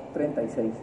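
very low, hushed mumble; sheepish admission. Acted. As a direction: barely say it, sunk right down. Repeats the sheepish instalment answer.

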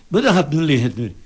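exasperated what the hell. Retold or imitated. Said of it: "y dices".

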